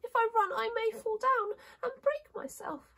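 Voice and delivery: very high, little princess voice; high-pitched fragile-doll character; timid china princess. Acted. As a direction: explain the danger in a tiny high voice.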